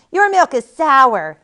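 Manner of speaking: put-on loud, slow, high-pitched baby complaint; accusing baby. A narrator's reading. Newborn in a nightmare complains to Buttercup, loud accusing voice. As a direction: drag out the complaint loudly. Dream baby berates its mother.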